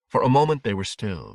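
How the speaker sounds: plain reading voice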